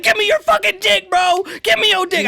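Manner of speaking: high, loud, manic demand; snapping, unhinged. Retold or imitated. On his knees to the bully: high, loud, manic mock demand. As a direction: go fully unhinged to scare him off. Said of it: "I fucking snap, bro".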